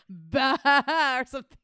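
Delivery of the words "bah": imitated bleating "bah"; goat bleat. Retelling the man at the bar, mock goat bleat. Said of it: "And he goes".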